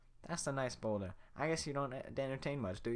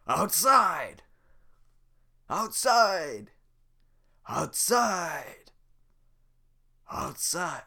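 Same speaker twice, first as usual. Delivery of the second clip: angry roar, higher and louder; irritated shout; 7 semitones higher, 13 dB louder, much slower than usual. Acted. Shrek throwing Donkey out, shouting. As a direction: bellow him out the door. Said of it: "Shrek is irritated".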